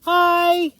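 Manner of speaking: very high, loud cheery hail; excited shout. Acted. Red shouts hello to Blue, high and loud. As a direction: call out bursting with excitement.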